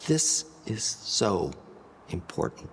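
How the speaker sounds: hushed whisper demo. Shown as a demonstration. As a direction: sink to a hush on important. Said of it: "changing your volume to be even softer".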